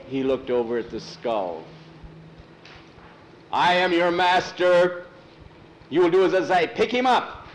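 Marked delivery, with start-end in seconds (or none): 3.5-7.5 loud, high villain orders, building higher; commanding master. Acted. Skull asserting he is Thor's master, commanding. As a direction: order him imperiously at full voice.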